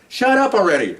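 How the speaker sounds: high, exasperated mock yell; exasperated mock shout. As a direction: snap at her, fed up. Man telling girlfriend to stop.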